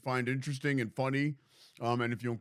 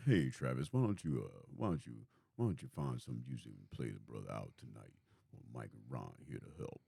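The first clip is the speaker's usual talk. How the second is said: smooth deep voice; 13 semitones lower, 7 dB quieter than usual. Shown as a demonstration.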